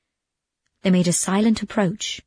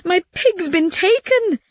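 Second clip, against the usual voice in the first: high-pitched, acted distress; blubbering; 10 semitones higher than usual. A narrator's reading. Calandrino tells his friends of his stolen pig, practically blubbering. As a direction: wail it, near to blubbering. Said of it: "he was practically blubbering".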